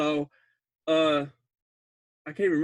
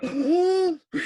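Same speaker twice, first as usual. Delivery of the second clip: gasp; 10 semitones higher, 4 dB louder than usual. Retold or imitated. Scared girl in scene retold.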